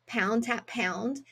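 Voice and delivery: "pound to help us spell" slowly and softly; slow down to name the skill; slow and deliberate. Shown as a demonstration. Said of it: "a skill called".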